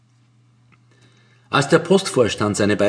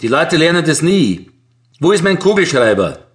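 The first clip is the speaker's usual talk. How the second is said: raised, irritated postmaster grumble; scolding loudly; 5 semitones higher, 5 dB louder than usual. A narrator's reading. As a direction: still grumbling, loud and raised. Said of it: "schimpft er laut".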